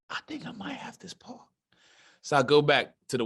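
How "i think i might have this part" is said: hushed whisper to himself. Retold or imitated. Actor whispering to himself after the photo shoot, barely voiced. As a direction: breathe it out as a secret hope. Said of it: "And I said".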